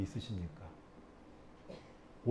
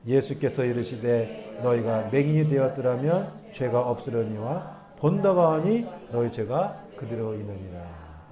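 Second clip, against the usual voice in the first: slow, measured Bible reading voice; scripture reading; 4 dB louder, slower than usual. Acted. Pastor reading the verses to the congregation, measured and formal. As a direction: read the verses out to the church. Reads Jesus' words from scripture.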